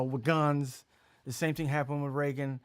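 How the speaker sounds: ordinary talk, nothing marked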